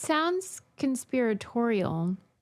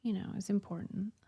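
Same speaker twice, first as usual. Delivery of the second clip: whispering; 4 semitones lower, 9 dB quieter, faster than usual. Real, not acted. Whispered aside.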